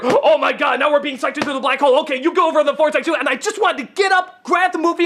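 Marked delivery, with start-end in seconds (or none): 0.0-3.1 fast, breathless mock alarm; parodying the film's jump back to danger; mock sudden panic. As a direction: rattle it off as instant crisis. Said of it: "two seconds later".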